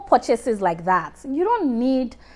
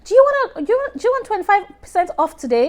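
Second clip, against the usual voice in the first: much higher, fast pushy sales pitch; hammed-up cashier pushing the discount; cashier Rachel; 11 semitones higher, faster than usual.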